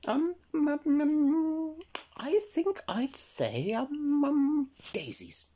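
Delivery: high, hesitant little rabbit, slow on the ums; a shy high rabbit dreamily choosing daisies; timid high rabbit voice; Miss Rabbit dithering. Acted.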